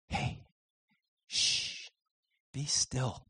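soft hushed soothing; gentle hush. Pretend. God speaking to us in our distress, soft and hushed. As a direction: hush them softly into calm.

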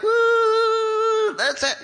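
high, loud whoop of a cheer; mock party cheer. Preacher imagines the congregation whooping at communion. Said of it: "having communion like".

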